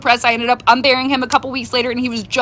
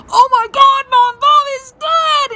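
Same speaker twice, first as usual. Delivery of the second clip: high, slow mock wail; hammed-up sobbing much higher; imitating scream sobbing; 13 semitones higher, 4 dB louder, slower than usual. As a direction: wail it like a devastated kid. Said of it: "scream sobbing on the phone".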